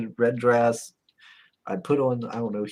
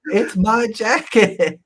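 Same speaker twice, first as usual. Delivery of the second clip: slow, spelled-out protest; drawn-out, half-laughing claim to his own jacket; insisting; 10 semitones higher, 7 dB louder, much slower than usual. Retold or imitated. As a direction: lay each word down slowly.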